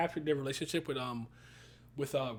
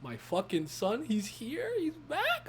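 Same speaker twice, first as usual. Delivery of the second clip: imitating the mom; 9 semitones higher, slower than usual. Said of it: "She's like".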